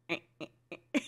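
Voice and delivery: tiny, low, quiet "eh" grunts; short soft grunts, nudging someone over; imitated fiance's grunt. Imitating fiance readjusting a sleeper's head, soft little grunts. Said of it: "He goes".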